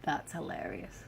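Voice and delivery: low, slow and quiet; deadpan amusement. Real, not acted. Vlogger reacting to the mold, deadpan. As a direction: drop it down and draw it out. Repeats reaction, lower and slower.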